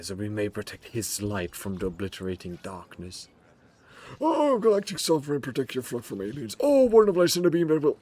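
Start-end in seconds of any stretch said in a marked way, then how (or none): 4.2-8.0 high, loud zealot prayer; fervent prayer. Acted. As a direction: pray like a zealot, loudly. Hammed-up prayer to the Emperor.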